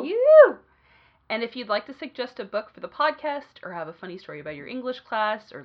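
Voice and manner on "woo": high, loud whoop; cheer. Real, not acted. Cheering after plugging their website, loud whoop.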